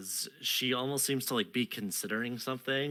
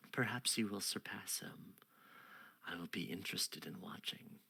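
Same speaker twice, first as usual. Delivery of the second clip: soft queen; 3 semitones lower, 10 dB quieter than usual. Acted. Queen murmurs to the knight. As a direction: lean in and hush it.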